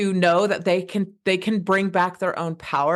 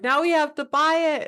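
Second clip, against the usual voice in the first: put-on higher, child-like alarm; son's voice; 9 semitones higher than usual. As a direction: voice the panicked little boy. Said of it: "and he's like".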